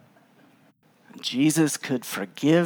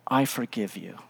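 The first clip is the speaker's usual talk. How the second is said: low, much softer, earnest pronouncement; solemn mock absolution; 6 semitones lower, 10 dB quieter than usual. Stranger to a fighting couple, mock-solemn forgiveness. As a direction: drop down soft for a grave pardon.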